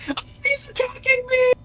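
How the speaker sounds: very high panicked squeak; high squeaky mock panic; mock alarm. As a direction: yelp it in mock fright. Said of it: "he's like".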